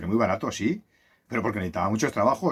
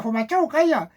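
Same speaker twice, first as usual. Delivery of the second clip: a hint of a squawky parrot, higher; 4 dB louder than usual. Parrot repeating the owner's order to the dog, lightly mimicked. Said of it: "el loro lo decía".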